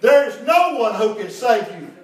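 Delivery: loud, high pulpit declaration; emphatic preaching. Acted. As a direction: drive it home to the whole congregation. Preaches at full force.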